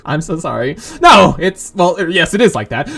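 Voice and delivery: usual speaking voice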